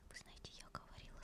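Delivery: ordinary talk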